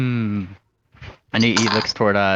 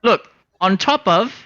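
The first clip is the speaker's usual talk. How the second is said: pitched way up, pleading and urgent; a flighty little rascal egging them on; high excited bird voice; 9 semitones higher, 3 dB louder than usual. Acted. Coaxing the drunk sailors toward the warehouse, high and eager.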